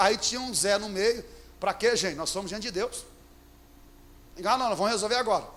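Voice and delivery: ordinary talk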